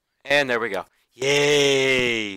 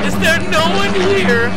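high-pitched panicked yelps, much higher; panicked cries for help; slower than usual. Real, not acted. Gamer whose character is drowning, shrieking in real panic. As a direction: shriek for help as the water closes in.

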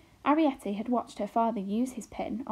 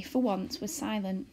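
plain reading voice

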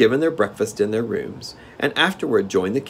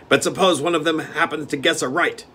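steward; 8 semitones higher, 4 dB louder than usual. Acted. Steward objects.

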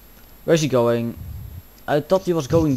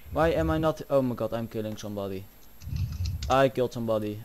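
normal talking voice

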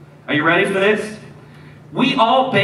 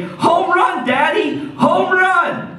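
put-on much higher, excited kid voice; little boy cheering; 10 semitones higher, slower than usual. Pastor voicing the boy cheering to his dad, high excited kid voice. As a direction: squeal it like a four-year-old.

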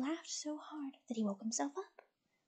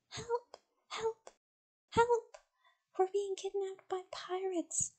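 high, panicked cries for help; yelling for help; 7 semitones higher, 3 dB louder than usual. A narrator's reading. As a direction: shout for help, much higher. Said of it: "yelled Grandma and Oliver".

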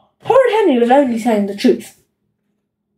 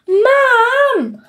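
much higher wail for Mum; 10 semitones higher than usual. A narrator's reading. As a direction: wail for your mother like a little kid. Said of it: "wailed Peter".

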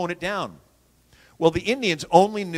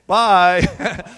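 breezy mock farewell; 3 semitones higher, 6 dB louder than usual. Joke sign-off of Congress note.

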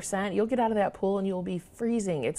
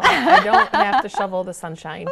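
laughing joke; 9 semitones higher, 10 dB louder than usual. Real, not acted. Joking about Arizona winters.

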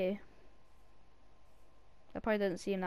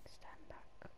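whisper; 18 dB quieter than usual. Real, not acted. Whispered in game.

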